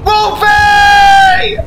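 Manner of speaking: high, loud furious shout at a teammate; outraged yell. Real, not acted. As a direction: yell at him for getting you killed. Betrayed by teammate, yelling.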